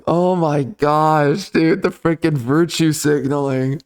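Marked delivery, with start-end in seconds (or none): none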